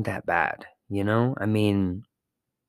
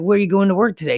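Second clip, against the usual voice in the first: chatty customer; 10 semitones higher, 5 dB louder, faster than usual. Retold or imitated.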